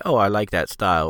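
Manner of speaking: normal talking voice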